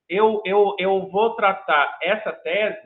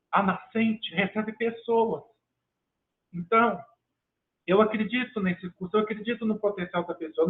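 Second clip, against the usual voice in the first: usual speaking voice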